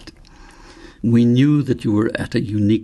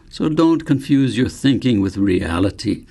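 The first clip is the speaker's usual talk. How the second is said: ordinary delivery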